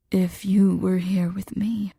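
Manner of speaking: slow, rough whisper; roughened voice. Acted. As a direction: let the words scrape, slow and close. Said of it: "his voice roughened".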